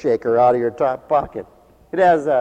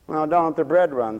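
ordinary talk, nothing marked

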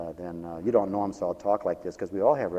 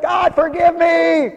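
long, high shouted plea to God; mock desperate cry; 11 dB louder, much slower than usual. Preacher confessing greed to God, mock desperate holler. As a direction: holler it out slowly, hammed up.

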